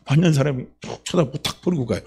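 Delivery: ordinary delivery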